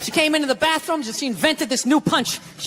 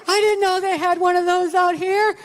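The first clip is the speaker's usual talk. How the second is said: high, drawn-out squeal of a put-on woman's voice; mock her slow, high-pitched thrill; gushing excited woman; 6 semitones higher, slower than usual. Woman spotting a clothing store to her man, high mock gushing.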